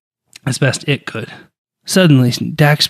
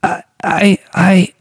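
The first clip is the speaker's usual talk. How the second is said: put-on high, stammering young voice; awestruck stammer; 7 semitones higher, much slower than usual. A narrator's reading. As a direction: stutter it out in shock, pitched up. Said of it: "awestruck whisper of Dak's words".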